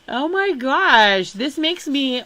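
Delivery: overwhelmed by the famous names; exasperated outburst. Real, not acted. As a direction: blurt it out in disbelief. Exasperated at another famous relative.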